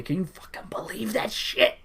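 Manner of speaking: unvoiced, conspiratorial whisper. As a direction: hiss it like gossip. Priest quote ends in whisper.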